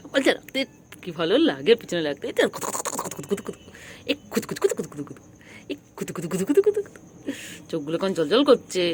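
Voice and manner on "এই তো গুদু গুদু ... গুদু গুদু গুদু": rapid-fire nonsense babble; tickling patter. Real, not acted. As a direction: rattle it off while tickling the pet. Tickling the pet with nonsense words. (দ্রুত কাতুকুতুর বুলি)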